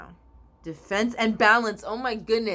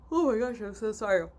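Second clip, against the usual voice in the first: talking through a yawn, higher pitched; 3 semitones higher, faster than usual. Real, not acted. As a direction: apologise while stifling a real yawn. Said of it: "I keep yawning".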